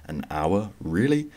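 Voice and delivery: incredulous, pitch jumping up at the end; imagined listener's surprise. Acted. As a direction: react in mock disbelief. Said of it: "Now you might think".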